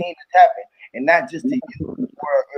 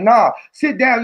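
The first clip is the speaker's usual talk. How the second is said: mock stern teacher; 7 semitones higher, 4 dB louder, slower than usual. Teacher refusing a student.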